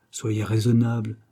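usual speaking voice

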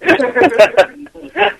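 giggling high; high-pitched laughter. Real, not acted. Laughing after worst prank.

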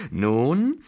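gleeful cackle into a loud, high taunt; laugh, then crow in victory; triumphant old lady's laughing challenge; wild triumph. A narrator's reading. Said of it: "in wildem Triumphe".

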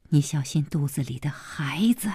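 soft, urgent warning; worried husband, voice held down; hushed caution. A narrator's reading. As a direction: plead under the breath. Husband cautioning pregnant wife.

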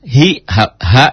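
ordinary talk

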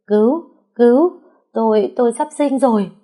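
high, loud pained cries for help; crying out in pain. Acted. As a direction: gasp it out as if labour has begun. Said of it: "kêu lên đau đớn".

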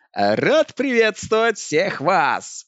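high, loud, slow stage greeting; elf singer greeting. Acted. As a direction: greet the crowd like a star. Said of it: "произнёс мой кумир".

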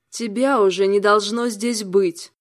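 slow, loud, through gritted teeth. Acted. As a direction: spit it out through clenched teeth. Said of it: "сквозь зубы процедила демоница". (сквозь зубы)

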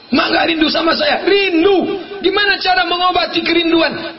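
high, emphatic retold exchange; acted emotional questioning. Acted exchange about missing each other. (dialog rindu dengan nada tinggi)

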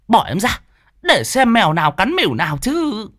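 shrieking. Acted. Enraged woman screeching at the man holding her back. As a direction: spit out the curses, wild with rage. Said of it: "rít lên".

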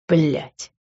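ordinary delivery, nothing marked

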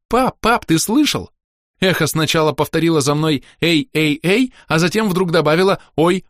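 put-on high, eager girl's voice; excited child. A narrator's reading. Daughter tells her father about a wondrous echo, excited and high.

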